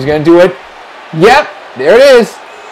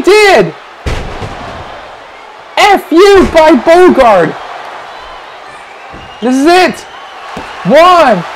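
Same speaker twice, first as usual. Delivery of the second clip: higher, rising commentator yell; excited shouting; 8 semitones higher, slower than usual. Acted. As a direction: scream the near-fall count. Near-fall climax, shouting commentary.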